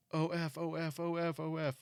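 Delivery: high, panicky wife imitation; mock her shrieking the letters in alarm; wife mock-panicking as husband floors it; freaking out. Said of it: "freaking out".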